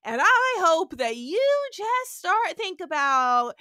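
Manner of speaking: loud, high grandstanding Kirk; hammed-up heroic speech. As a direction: declaim it like a self-sacrificing hero. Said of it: "He's like".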